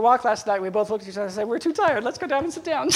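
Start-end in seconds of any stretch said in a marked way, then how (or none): 1.5-2.9 mock weary couple. Retold couple's tired decision.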